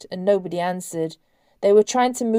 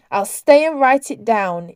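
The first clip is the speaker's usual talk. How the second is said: louder, firm defiance on stay; defiant; 3 semitones higher, 6 dB louder than usual. A narrator's reading. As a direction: dig in and refuse to be pushed out. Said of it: "Imogen said defiantly".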